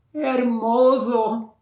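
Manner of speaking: long, high, loud calling of the name; crying out. Acted. Princess crying out to the prince below, high loud call. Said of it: "cried out the princess".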